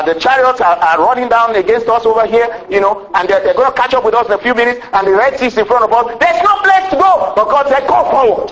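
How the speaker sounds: high, quick, urgent sermon delivery; full-force preaching. Real, not acted. Preacher retelling the chase to the congregation, full-force preaching. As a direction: drive it up to a high-pitched peak. Preaches the trapped crowd's plight.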